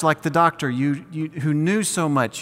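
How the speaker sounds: ordinary talk, nothing marked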